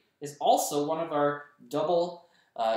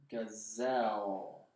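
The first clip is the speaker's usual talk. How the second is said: Gazelle drawn out slowly and quietly; quiet second sound-out; slow, soft sounding out; 3 semitones lower, 4 dB quieter, much slower than usual. Shown as a demonstration. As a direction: ease Gazelle out slowly, softer. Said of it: "And I'm hearing an L there".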